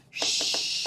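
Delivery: drawn-out shushing hiss; long hushing shh. Shown as a demonstration. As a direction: hush the room with one long shh. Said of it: "I'm whispering".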